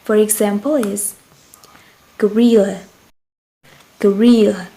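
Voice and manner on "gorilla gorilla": "gorilla" with the middle syllable punched louder; teacher's model "gorilla", weight on RIL; stress on second syllable. Shown as a demonstration. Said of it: "higher and louder".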